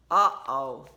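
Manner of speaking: a knowing parental uh-oh; mother foreseeing smelly socks, worried. Acted. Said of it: "said Tina's mother".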